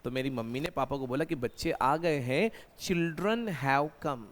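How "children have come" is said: very slow, spaced words; slow dictation. Shown as a demonstration. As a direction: model the phrase for students. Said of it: "ये बात ध्यान रखना". (धीरे-धीरे उदाहरण)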